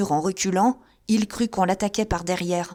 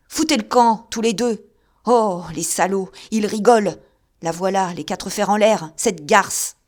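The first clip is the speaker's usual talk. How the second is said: loud, frantic horrified narration; delirious man seeing a murder; horrified raving; 5 dB louder than usual. Acted. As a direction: rave at the bloody vision. Raving about imagined massacre.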